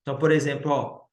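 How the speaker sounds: ordinary delivery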